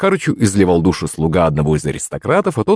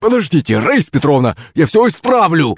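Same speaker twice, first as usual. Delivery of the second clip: higher, hoarse desperate cry; hoarse panicked shout; 6 semitones higher than usual. Acted. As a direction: beg her to stop, voice cracking. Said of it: "закричал осипшим голосом Захарыч". (осипший испуганный крик)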